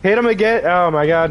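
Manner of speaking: ordinary delivery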